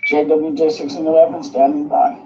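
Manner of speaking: crackly walkie-talkie transmission; thin, filtered radio sound. Real, not acted. Radio check over two-way radio.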